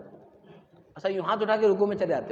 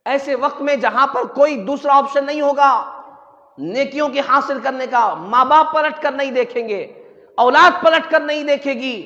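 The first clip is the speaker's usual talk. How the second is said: loud, impassioned sermon; raised, ringing preacher's voice; full-force preaching; 4 semitones higher, 7 dB louder than usual. Real, not acted. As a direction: hammer out the warning to the faithful.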